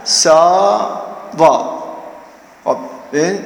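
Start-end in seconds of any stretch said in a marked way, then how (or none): 0.0-1.8 تساوا drawn out very slowly, each syllable separate; slow spelled-out. Shown as a demonstration. Teacher to students, stretching تساوا slowly while writing it.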